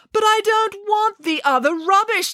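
high-pitched indignant refusal; exasperated, high protest; indignant declaration. A narrator's reading. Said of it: "declared Nanny Piggins".

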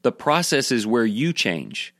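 normal talking voice

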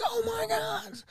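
very high falsetto cry; ecstatic fan. Retold or imitated. Wrestling fan as Warrior shakes ropes, ecstatic. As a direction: squeal it in mock ecstasy.

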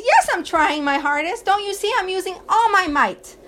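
put-on a small boy insisting he's doing his best; little boy protesting. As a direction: son pushing the dresser, answering his father in a high, indignant little boy voice. Little boy answers his father.

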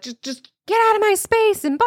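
high, tense snap, not a full yell; snapping at family. Retold or imitated. Snapping at her family to get out of her space, tense. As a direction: bite it off sharp and high. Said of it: "I'd be like".

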